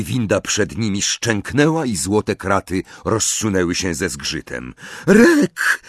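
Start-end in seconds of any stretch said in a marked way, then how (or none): 5.1-5.8 high, loud shout of a name; sudden high-pitched call; cry out. A narrator's reading. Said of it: "krzyknęła pani Cattermole".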